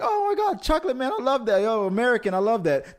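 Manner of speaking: high, gushing squeal of delight; excited girls. Retold or imitated. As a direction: gush with delight. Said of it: "the first two girls were like".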